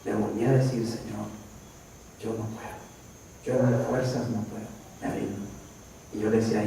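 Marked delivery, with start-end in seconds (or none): 0.9-5.5 very soft, low prayer of defeat; hushed retold confession to God; quiet surrender. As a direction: murmur it humbly, giving up.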